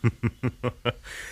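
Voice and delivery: short scoffing laugh; a dry knowing chuckle. Acted. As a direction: scoff at his naive idealism. Scornful laugh at idealist.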